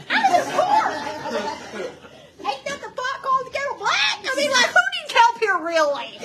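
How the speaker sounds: high, loud mock indignation; incredulous comeback. Telling off her rich client, incredulous. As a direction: rant in laughing disbelief.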